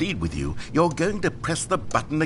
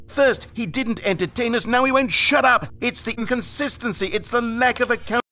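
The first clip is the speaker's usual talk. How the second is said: higher and louder whining complaint, building; mocking critic rant; 8 semitones higher, 4 dB louder than usual. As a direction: mimic the outraged fans. Narrator parodies angry reviewers.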